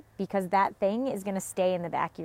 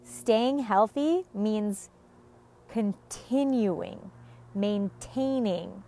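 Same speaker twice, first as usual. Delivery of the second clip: slow deliberate; much slower than usual. Real, not acted. Stressing key words slowly.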